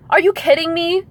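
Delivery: high, rising disbelief; incredulous. Real, not acted. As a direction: ask it in shocked disbelief. Incredulous repeat of the question.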